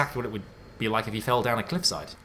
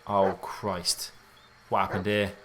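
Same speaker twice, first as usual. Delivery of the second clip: ordinary talk, nothing marked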